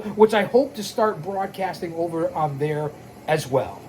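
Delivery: ordinary talk, nothing marked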